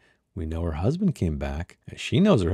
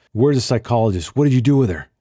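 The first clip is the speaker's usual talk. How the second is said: loud, demanding yell; angry shout; 4 semitones higher, 7 dB louder than usual. Acted. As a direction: bark the question at her. Surveyor shouting at her.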